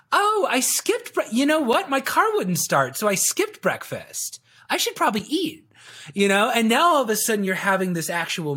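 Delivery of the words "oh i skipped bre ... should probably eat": lightly voiced prospect thinking aloud, higher; mock a professor suddenly remembering breakfast; musing prospect. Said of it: "now they're like".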